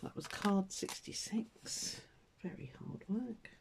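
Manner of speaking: whispering to herself while lining up the pen; hushed unvoiced mutter as she concentrates. Real, not acted. Crafter murmuring to herself over her drawing, whispered.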